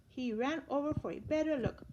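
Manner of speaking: ordinary delivery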